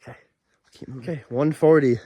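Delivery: ordinary talk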